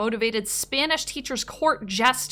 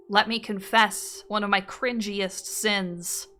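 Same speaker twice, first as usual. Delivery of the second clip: ordinary delivery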